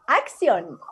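ordinary talk

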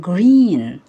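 "green" said loud with a falling tune. Shown as a demonstration. Teacher naming the colour word for pupils, loud and clear. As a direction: announce "green" clearly to the class. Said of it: "اللون ده اسمه ايه؟".

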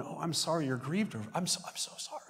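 soft, high consoling voice, fading to whisper; imitating oversolicitous comforters, soft and high; mock sympathy. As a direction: fawn over them in a hushed apology. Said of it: "people going".

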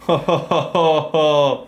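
slow, hammed-up ho-ho-ho; a put-on jolly laugh, drawn out slow; mock Santa laugh. Streamer teasing chat about their weak picks, hammed-up ho-ho laugh.

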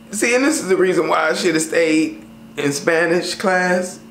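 usual speaking voice

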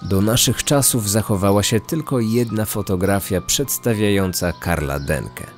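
plain reading voice

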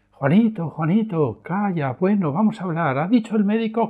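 a hint of a weary parent; coaxing the child to stop; parent pleading. Parent reasoning with tantruming child.

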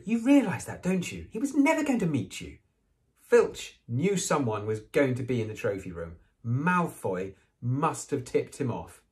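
high, knowing schoolgirl lecturing; bossy girl telling Harry he was tricked; Hermione's voice. Acted. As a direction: set him straight, a bit superior.

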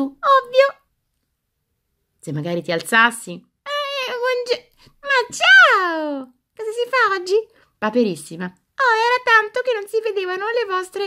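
high, chirpy toy doll voice; squeaky doll voice. Acted. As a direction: play a cheeky little doll. Adult voices toy doll Alice.

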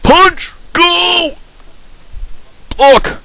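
high, urgent yells; high-pitched battle commands; urgent calling out. Real, not acted. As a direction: bark out game moves in a high voice. Calling out moves in game.

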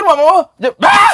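high, loud stumble into a mock scream; Dev Liber. Comic retelling a tongue-tied comedian's flub, mock exasperated scream. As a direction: give up on the word with a sudden yell. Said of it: "Dia emosi dengan lidah sendiri".

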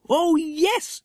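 high delighted yes; children agreeing together, delighted; eager chorus. A narrator's reading. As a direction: agree gladly.

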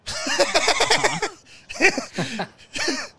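high, loud burst of laughter; laughing. Real, not acted. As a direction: crack up for real. Laughs at camping excuse.

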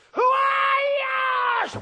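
drawn-out high roaring cry; giant's roar. Acted. The giant Ishbi-benob roaring at David, a long cry. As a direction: stretch it out slowly like a roaring giant.

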